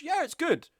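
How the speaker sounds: high, lifeless mock answer; bored friend reply. Friend asked about the movie, high mock-bored reply.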